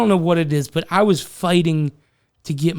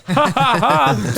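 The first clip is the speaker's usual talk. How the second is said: fake, much higher mocking ha-ha; sarcastic laugh; 9 semitones higher, 3 dB louder, much slower than usual. Worker mocking the pranksters, forced sarcastic ha-ha-ha. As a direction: laugh in their faces on purpose. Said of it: "I was acting like that".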